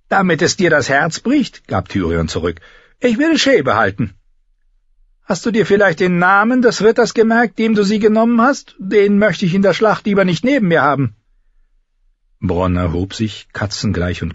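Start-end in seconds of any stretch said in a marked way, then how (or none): 0.1-1.7 high-pitched character retort; raised, put-on retorting voice; voiced retorting nobleman. Tyrion's retort in dialogue. 3.0-11.1 high, insistent character voice; high voice, pressing the point; Tyrion, wry lordling. Acted. Tyrion's dialogue in narration.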